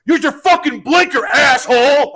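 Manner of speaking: high, loud yell of an irate driver; mock road-rage shout; imitated angry yell. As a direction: yell it like a stranger at a bad driver. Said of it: "This guy yells out".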